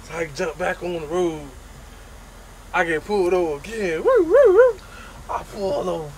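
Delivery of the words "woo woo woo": siren imitation.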